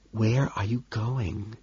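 slow, soft puzzled whisper; bewildered whisper. A narrator's reading. Lewis whispering to Patrick, bewildered and soft. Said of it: "he whispers, bewildered".

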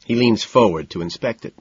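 usual speaking voice

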